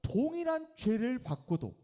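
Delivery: high, loud scolding; stern rebuke. Acted. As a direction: rebuke the other robber sharply. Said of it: "꾸짖어서 이르되". (동료 강도를 꾸짖는 강도, 높고 큰 호통)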